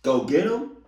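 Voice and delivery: high, loud rising question; incredulous echo. Acted. Reactor questioning mom's order, loud incredulous echo. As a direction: repeat it loudly in disbelief.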